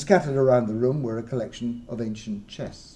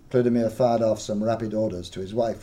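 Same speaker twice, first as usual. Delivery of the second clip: ordinary delivery, nothing marked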